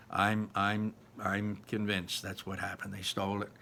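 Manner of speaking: ordinary delivery, nothing marked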